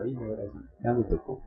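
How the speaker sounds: hushed, resigned aside to himself; retold dread. Boy summoned by his mother to the room, retold hushed dread.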